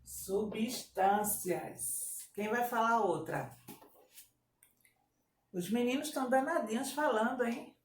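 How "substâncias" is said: very slow, stretched "Substâncias"; syllable-by-syllable dictation. Shown as a demonstration. Teacher dictates word by syllables.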